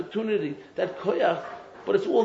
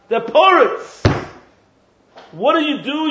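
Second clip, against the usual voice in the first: ordinary talk, nothing marked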